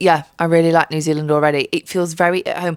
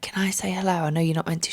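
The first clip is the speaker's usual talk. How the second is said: softer, breathy whispered ask; whispered request; 6 dB quieter than usual. Retold or imitated. Asking the dog handler, in a whisper. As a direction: whisper it like a secret. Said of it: "I was like".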